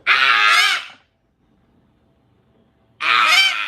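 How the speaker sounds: very high, loud imitated screech; piercing mock screams, one after another; mad bird scream. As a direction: mimic an angry bird shrieking. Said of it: "When she's mad, she goes".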